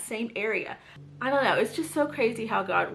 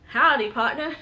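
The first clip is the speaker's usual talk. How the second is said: louder hammed-up Southern cowboy greeting; silly Southern cowboy; 2 semitones higher, 6 dB louder than usual. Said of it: "Okay, that was totally silly".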